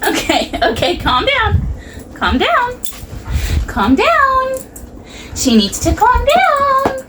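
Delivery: very high pleading with the dog; dog-talk voice. Real, not acted. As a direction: beg the dog to settle, way up high. Trying to settle excited dog.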